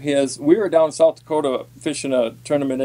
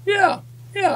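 mock eager yes. Eager reply retold.

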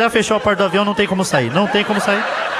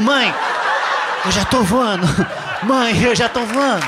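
mock child's singsong; slower than usual. Little girl pestering her mother mid-flight, chanting singsong. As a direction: sing it like a pestering kid. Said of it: "Ela falou".

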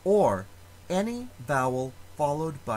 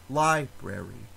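LIBRARY with its first syllable punched; stress on first syllable; slower than usual. Shown as a demonstration. Teacher showing which syllable of library is stressed, first-syllable stress. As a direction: say "library" leaning hard on the opening LI. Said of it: "the first of the three syllables receives the stress".

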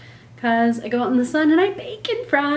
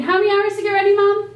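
raised, high teasing call-out; calling to Mom; 9 semitones higher, 5 dB louder, faster than usual. Real, not acted. Daughter asking mom how long she takes, calling out high. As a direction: pitch it up and call across the room.

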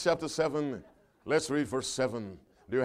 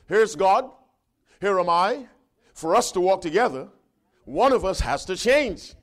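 loud, raised pulpit proclamation; higher, ringing preacher's voice; forceful preaching; 7 semitones higher, 11 dB louder than usual. Acted. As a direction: drive the point home with full conviction. Preaches the climax to congregation.

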